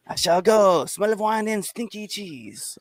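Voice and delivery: high, slow hammy French voice; high mock French accent. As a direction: play the snooty Frenchman leaving. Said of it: "You sound French as fuck".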